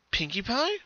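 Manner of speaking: put-on worried young pony; Twilight voice. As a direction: check gently on a friend. Twilight asks again.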